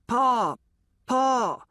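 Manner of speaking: high, loud cry of Pa; calling hoarse and high from the grave; crow-like calling out. Acted. Boy calls up to his father from the grave, high and loud. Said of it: "I sound like one of them crows".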